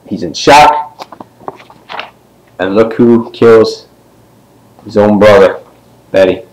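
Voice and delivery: ordinary delivery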